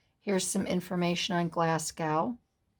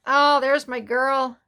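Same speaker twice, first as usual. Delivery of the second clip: higher, louder, slowed gush of joy; delighted; 7 semitones higher, 8 dB louder than usual. Real, not acted. Seeing her pen pal's photo again, gushing delight. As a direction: coo over the photo.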